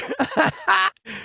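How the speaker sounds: high burst of real laughter. Real, not acted. Actor laughing after the stranger says he cannot act. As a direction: crack up at the verdict.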